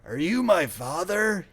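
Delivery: put-on slow, high, cracked old man; cracked ancient voice. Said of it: "spoke in a cracked and ancient voice".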